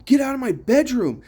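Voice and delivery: high-pitched retold yell. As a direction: act out yelling at an intruder to get out. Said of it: "I keep yelling at him".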